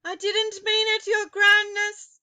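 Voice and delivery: high, loud frightened plea; cried out. A narrator's reading. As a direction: beg for mercy in panic. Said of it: "the witch cried out".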